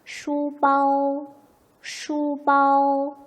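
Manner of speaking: slow, loud 슈바오, both syllables high and level; tone demo. Shown as a demonstration. Teacher models two first tones, high level tone demo. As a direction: hold 슈바오 on one high note. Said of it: "한번 해볼까요?".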